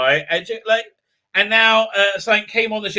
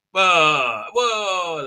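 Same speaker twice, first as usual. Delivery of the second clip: ordinary talk, nothing marked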